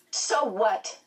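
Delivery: demanding retort. A narrator's reading. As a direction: demand it back at the principal. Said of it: "Meg demanded".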